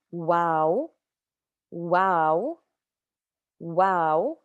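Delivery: "wow" said with a plain W; demo waw. Shown as a demonstration. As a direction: say waw clearly. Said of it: "is gonna make a w sound".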